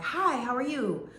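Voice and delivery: lightly voiced woman rehearsing her greetings; mock-sunny small talk in front of the mirror; practiced cheery greeting. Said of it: "practicing her etiquette".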